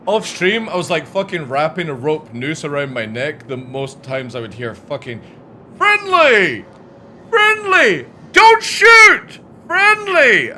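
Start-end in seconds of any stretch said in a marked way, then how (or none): 5.8-10.5 high, loud, whining pleas; mocking whiny player. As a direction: beg shrilly like a scared gamer. Mocking players begging not shot.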